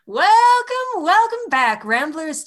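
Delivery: booming show host welcome; announcer voice. Acted. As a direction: belt it like a showman. Host's big show opening.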